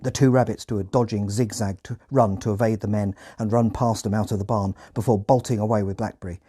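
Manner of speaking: usual speaking voice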